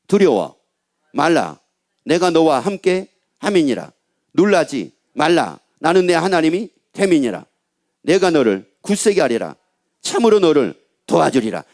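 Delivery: low, measured Bible verse reading; scripture reading. Acted. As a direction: pronounce the promise in low, separated phrases. Reads God's promise from Isaiah. (낮고 느리게 끊어 읽는 성경 낭독)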